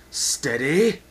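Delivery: sharp loud snap of an order; curt shouted command; snapped. Acted. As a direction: snap it at them. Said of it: "snapped Spartan Shield".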